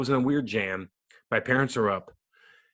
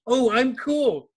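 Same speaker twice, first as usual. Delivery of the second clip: high, slow, louder mock cockiness; cocky rookie; 12 semitones higher, 5 dB louder, much slower than usual. Imitating his rookie self before a big hit, high and cocky. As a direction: drawl it like a kid who thinks he's untouchable. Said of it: "I had on the wall, like".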